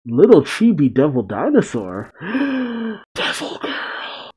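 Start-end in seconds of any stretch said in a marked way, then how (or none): 2.2-4.3 slow, high whispered squeal; awed gasp. Real, not acted. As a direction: draw it out softly in high, hushed amazement. Gasping at a surprise reveal.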